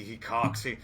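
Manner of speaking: ordinary talk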